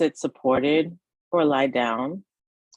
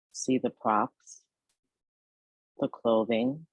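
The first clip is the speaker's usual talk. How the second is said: guided meditation; 2 semitones lower than usual. Acted. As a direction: ease the listener toward rest, phrase by unhurried phrase. Leads a yoga nidra meditation.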